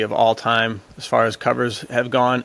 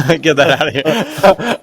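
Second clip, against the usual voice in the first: laughing dismissal; 5 semitones higher, 5 dB louder, slower than usual. Real, not acted. Podcast guest laughing off a Fabio cover, laughing. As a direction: laugh through it, louder and slow.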